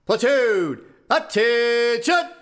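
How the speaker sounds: barked military command; drill command. Acted. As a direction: call the platoon to attention.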